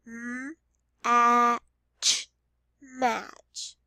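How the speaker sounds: "M, a, ch" apart then "match"; sounding out then blending. Shown as a demonstration. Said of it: "finger stretch the word match".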